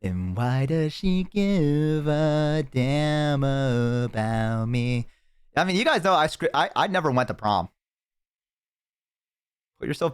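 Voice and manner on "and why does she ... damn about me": slow, wistful narrator voice drawn out; melancholy movie monologue. Pretend. Streamer imagining a sad prom scene, slow wistful voiceover. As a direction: play it like a lonely teen movie voiceover.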